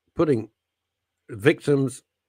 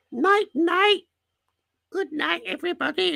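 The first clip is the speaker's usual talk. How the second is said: much higher, sing-song night night; cooing bedtime voice. Pretend. Owner tucking in her stick insects, high coo. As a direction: coo goodnight like tucking in babies. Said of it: "You go".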